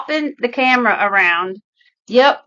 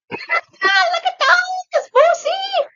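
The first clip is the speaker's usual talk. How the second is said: much higher, sing-song talk to a pet; baby talk to the dog; slower than usual. Acted. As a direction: coo at the dog in a high voice.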